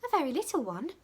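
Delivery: put-on high girlish reply; little maid answering Dick; small servant. A narrator's reading. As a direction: answer shyly. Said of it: "replied the small servant".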